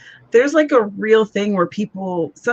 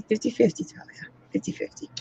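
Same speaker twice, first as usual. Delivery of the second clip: hushed aside; 2 semitones higher, 9 dB quieter than usual. Retold or imitated. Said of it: "And I'm like".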